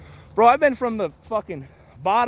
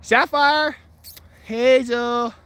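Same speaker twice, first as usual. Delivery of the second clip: raised, loud call; calling dogs; 6 semitones higher, 6 dB louder, much slower than usual. Real, not acted. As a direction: holler the pets' names.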